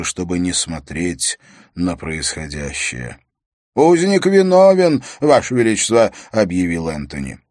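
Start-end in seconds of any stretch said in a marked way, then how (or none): 3.7-6.1 loud, raised proclamation; high, ringing formal declaration; court official announcing guilt. Acted. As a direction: announce the verdict to the throne. Chamberlain announces guilt to king.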